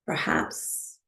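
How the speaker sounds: calm, unhurried meditation guide with long pauses; guided meditation. Acted. Teacher settling a class into breathwork, calm guided meditation. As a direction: ease them into stillness, phrase by phrase.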